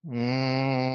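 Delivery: long steady humming bee breath; one even hum with the ears closed. Shown as a demonstration. Teacher humming with the class in Bhramari practice, steady hum. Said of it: "Bhramari practice".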